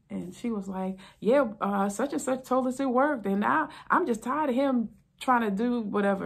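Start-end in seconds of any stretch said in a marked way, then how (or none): 1.2-6.3 fed-up wife. Retold or imitated. Wife explaining to officer.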